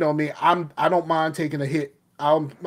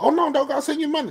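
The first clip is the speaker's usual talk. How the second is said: high, fast imitation of Blue; mock friend voice; 12 semitones higher, faster than usual. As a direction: squeak it out like a flustered friend. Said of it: "Blue being like".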